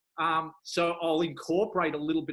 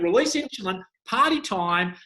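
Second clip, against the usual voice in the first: higher, louder party shout from the pancreas; mock party call; 5 semitones higher, 6 dB louder than usual. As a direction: hype it up like a party host. Pancreas voiced calling party.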